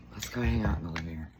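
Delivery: ordinary talk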